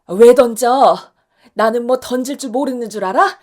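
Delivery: 아주 크게, 기죽지 않고 되받아쳐; 처음으로 남편에게 대드는 큰 소리; 맞받아치는 고함. Acted. 아내가 남편에게 맞받아침. (신발을 되던진 아내의 크고 당당한 맞고함)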